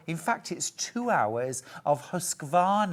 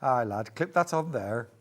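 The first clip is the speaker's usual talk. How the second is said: mock Yorkshire dialect; put-on Yorkshire accent; 3 semitones lower than usual. Shown as a demonstration. Host hams it up after her slip, mock Yorkshire accent. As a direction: play at being a Yorkshire local. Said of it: "I've no right to use that accent".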